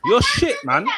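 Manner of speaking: high squeaky laughing insult; laughing taunt. Acted. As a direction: laugh it out mockingly.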